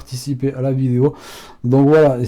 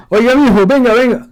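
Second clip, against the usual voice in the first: much higher and louder call, Ecuadorian style; Ecuadorian accent; 11 semitones higher, 8 dB louder than usual. Shown as a demonstration. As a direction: hail someone across the street. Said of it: "tout le monde s'appelle mijo".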